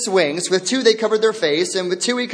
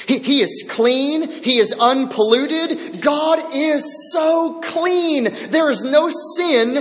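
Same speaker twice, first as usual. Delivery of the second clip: higher, slow fervent praise; 5 semitones higher than usual. Real, not acted. Preacher to the congregation, fervent exaltation. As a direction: lift clean and unpolluted.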